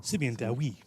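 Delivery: Simeon Dawi with a careful Welsh lilt; Welsh accent. Retold or imitated. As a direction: say Dawi the Welsh way, low and soft. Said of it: "attempt at a Welsh accent?".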